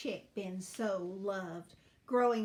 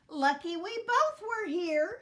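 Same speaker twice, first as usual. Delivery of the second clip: high, loud, relieved hen voice; glad agreement; 8 semitones higher, 7 dB louder than usual. A narrator's reading. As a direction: agree brightly and loudly. Hen happily agrees after victory.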